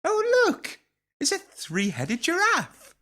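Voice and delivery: high, drawn-out mock wonder; children's show explorer. Explorer spots a three-headed giraffe, high children's show voice. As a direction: gasp it out like a kids' TV presenter.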